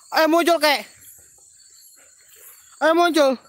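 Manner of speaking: high, loud urging call; shouted summons. Real, not acted. Host urges the old ghost to come out, shouting high. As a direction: urge someone out of hiding, shouting.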